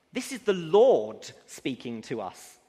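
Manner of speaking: slow, weighty pulpit voice on God made flesh; preaching at full force. Acted. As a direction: build it up and declare it to the congregation. Preaching climax on Jesus speaking.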